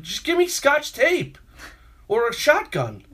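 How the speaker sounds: mock exasperated plea, pushed high; exasperated outburst. Worker at wit's end demanding tape, exasperated outburst.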